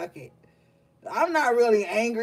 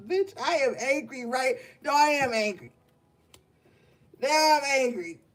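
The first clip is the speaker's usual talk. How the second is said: higher, pushed mock anger that keeps correcting itself into rage; hammed-up anger; 4 semitones higher than usual. To the gang, talking himself into a pretend fury. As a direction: ham up being angry, talking yourself into it. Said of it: "I'ma act like I'm angry".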